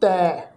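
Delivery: "ਤੈ" with a purely oral ai vowel; without nasal tone. Shown as a demonstration. Teacher showing this word's reading, "ਤੈ" without nasalisation. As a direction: keep "ਤੈ" out of the nose. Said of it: "ਬਿਨਾਂ ਨਾਸਕੀ tone ਤੋਂ ਪਾਠ ਉਚਾਰਨ ਕਰਨਾ ਹੈ".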